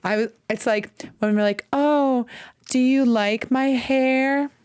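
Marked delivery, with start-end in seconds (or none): 1.7-4.5 high, slow coy fishing voice; sweetly angle for praise; fishing for compliments. Retold or imitated. Friend fishing for a compliment about her hair, coy and high.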